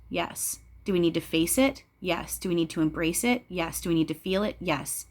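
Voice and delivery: ordinary talk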